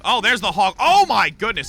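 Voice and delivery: loud excited exclamation; excited shout. Real, not acted. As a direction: react loudly to the play. Commentator reacts to kill.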